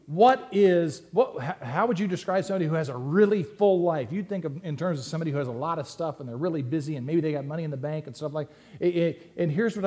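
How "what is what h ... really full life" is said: higher and louder mock coworker asking; lively imitation of a curious coworker; coworker's question. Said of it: "at work were to say to you".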